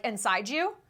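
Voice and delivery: ordinary talk, nothing marked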